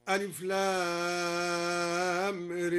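very slow ر with its long a leaned toward e, said re; imala on ra. Shown as a demonstration. Teacher demonstrates imala on the opening letters of Ibrahim, slow chant. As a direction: stretch ر and tilt it toward e. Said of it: "وأمال الراء".

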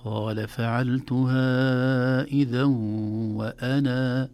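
slow chant stopping on وأنا with the long alif kept; pausing reading. Shown as a demonstration. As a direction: hold the alif of وأنا at the stop. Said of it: "لكن لو وقفنا". (إثبات الألف وقفا)

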